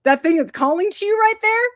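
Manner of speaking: high, loud mock call-out; careless god. God pointing out the weapon to a mere mortal, mock boasting. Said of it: "And then the god".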